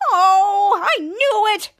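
high, loud mock exasperation; frustrated outburst. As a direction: act out an exasperated cry.

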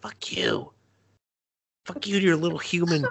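put-on grumpy voice. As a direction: spit it out in a silly voice. Acted insult in odd voice.

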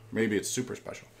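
ordinary talk

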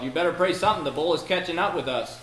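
loud, high-pitched panicked retort; urgent yell. Retold or imitated. Companion urging John to pray as bull gains, yelling. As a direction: yell it while fleeing, acted out. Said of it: "His companion yelled".

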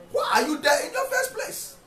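shouted repeat. Real, not acted. Preacher repeating his challenge to the assistant pastors, shouting. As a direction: throw the question back at them. Repeated question, far higher.

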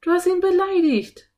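high, loud indignant reproach; reproachful. A narrator's reading. Anne scolding her brother over the octopus, reproachful. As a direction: accuse him indignantly.

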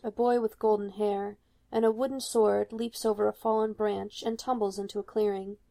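plain reading voice

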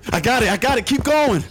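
high, loud, rushed calls; urgent shouts on the run; shouting while running. Acted. Running to catch the flight.

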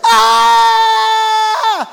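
huge high sustained shout. Shown as a demonstration. Preacher leads the congregation in the Jericho shout. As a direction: roar it out like the army at Jericho. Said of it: "And so they shouted".

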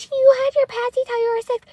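high-pitched mock teasing relatives; mocking imitation. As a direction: mimic them in a high, loud taunt. Mimicking teasing family members.

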